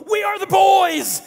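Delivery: high, drawn-out full-throated yell; imitating a wildly excited kid, very loud and high; Danny yelling. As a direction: bellow it like a chant over roller coaster noise. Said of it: "I remember hearing Danny yelling something".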